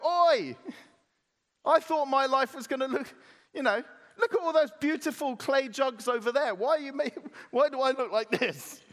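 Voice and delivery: high-pitched mock grievance; moaning complaint. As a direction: whinge like a hard-done-by lump of clay. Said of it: "can clay actually moan to the potter".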